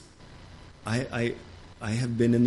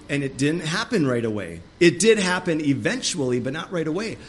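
normal talking voice

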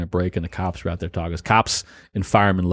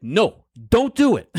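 slow, high imitated New York cop accent; retold refusal in a heavy cop accent, drawn out; 12 semitones higher, slower than usual. Cop refusing to riff with plumbers, heavy accent. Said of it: "in the heavy accent".

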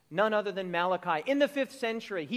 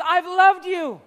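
higher and louder, punched; bold declaration; 7 semitones higher, 10 dB louder, slower than usual. Retold or imitated. Preacher voicing God's love, loud and bright. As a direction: announce it boldly. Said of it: "God says".

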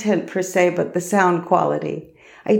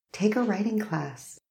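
soft, slightly higher inner voice; little inner voice; 4 semitones higher, 9 dB quieter than usual. Retold or imitated. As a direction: drop to a soft, coaxing murmur. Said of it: "a little voice inside that said".

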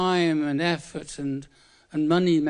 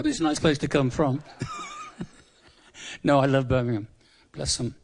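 ordinary talk

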